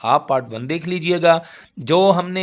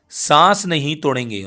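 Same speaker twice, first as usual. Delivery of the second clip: usual speaking voice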